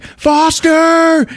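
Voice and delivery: long high shouted name; calling out. Retold or imitated. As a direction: yell the name into the air. Cop calling out killer's name.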